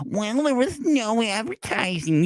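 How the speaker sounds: high, slow, sneering mimic of a whiny troll; mocking whiny complainer. Vlogger mocking avatar trolls, high slow whiny mimicry. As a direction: drag it out like a clueless whiner.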